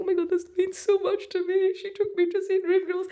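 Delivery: high, mock-tearful wail; imitating crying gush. Tearful date at a musical, high mock-sobbing gush. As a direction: squeak it out like a weepy fan at a musical. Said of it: "I was sitting crying, being like".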